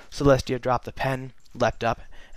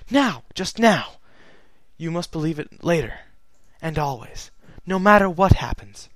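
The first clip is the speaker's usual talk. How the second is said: pleading Celestia; 5 semitones higher, 6 dB louder than usual. Acted.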